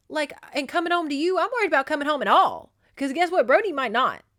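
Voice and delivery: loud, high-pitched retold tirade; acted furious scolding with hard-hit words; angry berating. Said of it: "as he's sitting there berating her".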